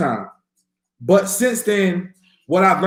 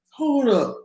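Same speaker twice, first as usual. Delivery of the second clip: startled; 7 semitones higher than usual. Retold or imitated. Retelling his shock while floating.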